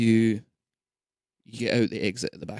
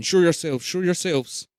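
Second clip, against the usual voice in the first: mock guard command, higher and louder; guard shouting; 6 semitones higher, 4 dB louder than usual. Armed guards ordering the hiders out, shouting. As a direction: bark an order at hidden people. Guards pointing guns at train.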